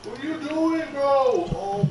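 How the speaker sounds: high-pitched delighted squeal; thrilled disbelief; excited. Real, not acted. Amazed at new pickaxe.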